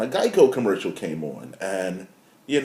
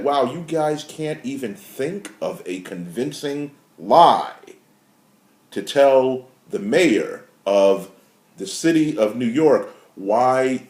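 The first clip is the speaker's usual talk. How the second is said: usual speaking voice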